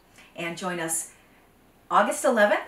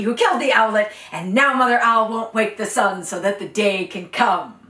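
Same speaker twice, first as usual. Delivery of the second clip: royal lion verdict; 3 semitones higher, 10 dB louder than usual. Acted. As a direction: lay out the chain like a judge. King Lion pronounces the chain.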